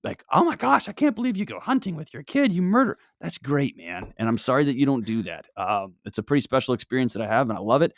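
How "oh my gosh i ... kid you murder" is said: high-pitched outraged troll parody; mock internet troll. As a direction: put on a high, scandalized voice. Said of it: "all the internet trolls".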